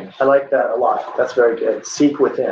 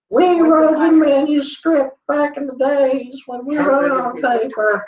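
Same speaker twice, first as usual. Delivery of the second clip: put-on higher quavering old-timer voice; oldie voice again; 9 semitones higher than usual. Teacher to students, acting an old person in a higher put-on voice. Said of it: "That's like an oldie".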